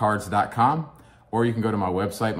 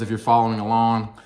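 usual speaking voice

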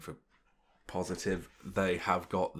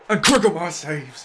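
loud, high burst of excitement at the save; real thrill, loud and high; excited shout; 8 semitones higher, 13 dB louder than usual. Real, not acted. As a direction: yell it as the keeper saves. Penalty shootout save, commentating live.

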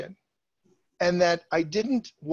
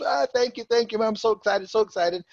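higher-pitched gushing gratitude; excited thanks; 5 semitones higher than usual. Retold or imitated. Client thanks his agent for the house, excited. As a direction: imitate a thrilled, grateful client.

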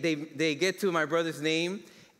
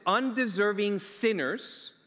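slow emphasis; 2 semitones higher, slower than usual. Real, not acted. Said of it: "Process this".

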